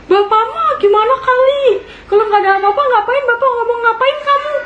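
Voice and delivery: high-pitched, indignant retort to dad; retold exasperated complaint; exasperated protest. Acted exasperated protest to father.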